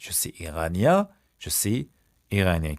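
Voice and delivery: Je suis iranien said slowly, split before iranien; slow chunked model. Shown as a demonstration. Teacher to class, slow chunked model. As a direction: break the sentence into pieces. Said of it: "slow model, broken into chunks".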